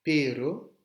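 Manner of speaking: PERO with one tongue tap, loud and high, rising; R simple. Shown as a demonstration. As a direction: model pero with a single short R. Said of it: "un simple R". (un seul battement de R)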